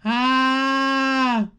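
high voiced cry of a pilot thrown back; mock scream. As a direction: yelp as the ship blasts off. Pilot screams as ship shoots out.

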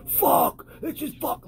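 high strained frustrated cry; losing it over weighing up, cry-like and high; retold snapping. Retelling snapping at work, high anguished cry. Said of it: "yo, I'm snapping".

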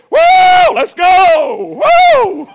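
very high, very loud whooping shout; joyful whoop. Retold or imitated. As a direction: let it rip like you finally dance. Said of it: "I said".

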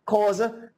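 "Xhosa" with a plain k, like KAW-za; Sandton pronunciation. Retold or imitated. Listing languages the Sandton way, "Xhosa" said as KAW-za without a click. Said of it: "as they call it in Sandton".

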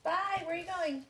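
bright bye called after him; calling out. Real, not acted. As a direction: call across the house to the stepson. Calling to stepson leaving house.

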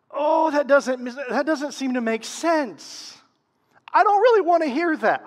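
loud, high objection; mock protest. Pastor voicing believers who balk at hard teaching, loud mock protest.

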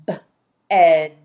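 "B-ed" with a lone b, then a stretched ed; sounded out. Shown as a demonstration. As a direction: tap out "B-ed" loudly, sound by sound.

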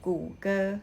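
"กูเกอร์" said as Mandarin gu-ge; "กูเกอร์" in Chinese tones; Mandarin reading. Shown as a demonstration. Teacher giving the Chinese name for Google, Mandarin tones. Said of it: "คนจีนอ่านว่า".

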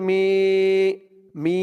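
"মি" with a long, held I; one-alif stretch. Shown as a demonstration. Teacher showing the madd to learners, "মি" with drawn-out I. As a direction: stretch the vowel of "মি" evenly. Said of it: "এক আলিফ টান জেরের পরে ইয়া সাকিন".

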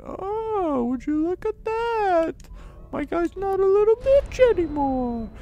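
very high falsetto squeal; mock gushing. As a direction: coo it in a silly falsetto. Mock gushing over upgraded character.

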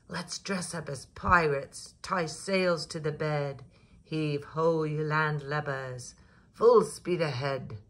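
loud hearty pirate call; pirate play. A narrator's reading. Children playing pirates call out, loud and hearty. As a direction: shout it like a pirate captain.

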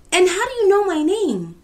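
high, loud, baffled demand; startled question. A narrator's reading. Kofi to a girl he doesn't know, startled question. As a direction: blurt it out in astonishment.

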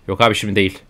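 ordinary talk

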